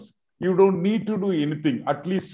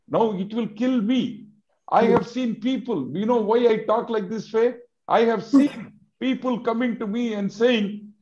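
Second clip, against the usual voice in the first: usual speaking voice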